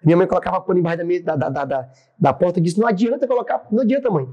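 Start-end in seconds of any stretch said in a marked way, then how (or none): 2.8-4.3 high-pitched, insistent objection; retold protest. Son tells mother the cloths are useless, retold protest. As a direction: imitate an urgent protest at a useless effort. Son argues during house flood.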